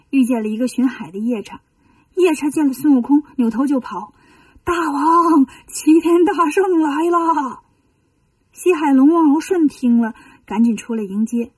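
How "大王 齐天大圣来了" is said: high, slow alarmed cry to the king; panicked call. Acted. Patrol yaksha running to warn his king, high alarmed call. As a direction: sound the alarm as you run.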